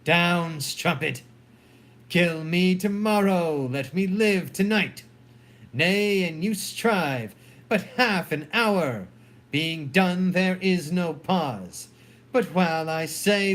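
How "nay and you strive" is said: ordinary talk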